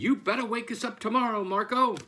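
fast, high scolding; stern warning. A narrator's reading. Farmer warning the rooster about tomorrow, fast high scolding. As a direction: rush the warning, landing loud on the name.

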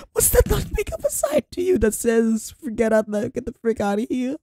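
very high squeaky voice; high squeaky frightened rant; squeaky panic. Real, not acted.

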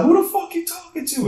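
high-pitched mocking voice of an angry woman; mock irate mother. Neighbor's mother snapping back at the barber, high and mocking. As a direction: squeak it out like a snippy neighbor lady. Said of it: "she was like".

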